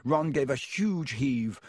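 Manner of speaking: usual speaking voice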